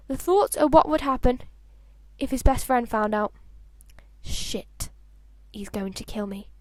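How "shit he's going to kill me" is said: hushed, breathy dread under the breath; whispered to himself in bed, much softer; panicked whisper. Acted. Harry fearing his best friend finds out, panicked whisper.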